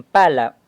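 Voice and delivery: pala con fuerza en la PA; pala, stressing the first syllable. Shown as a demonstration. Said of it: "indico la pa". (acento en la primera sílaba: pala)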